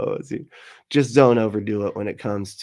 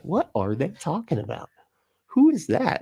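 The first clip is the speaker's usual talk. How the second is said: confused viewer; 8 semitones higher than usual. Retold or imitated. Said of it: "you're like".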